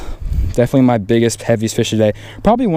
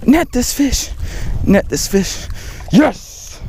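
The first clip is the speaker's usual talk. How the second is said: high, straining cries to land the fish; urgent, then a triumphant yes; frantic excitement; 11 semitones higher, much slower than usual. Real, not acted. Angler fighting a giant bass into the net, high frantic excitement.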